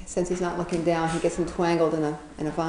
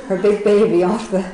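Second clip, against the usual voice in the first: louder, breaking into a laugh mid-phrase; laughing while talking; 2 semitones higher, 7 dB louder than usual. Real, not acted. Storyteller cracking up over the bird's big baby, laughing. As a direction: laugh through the words.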